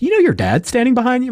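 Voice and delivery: high, loud put-on voice of an eager psychic; mock psychic. Practitioner telling him his dad stands behind him, mock excited. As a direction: play the breathless seer.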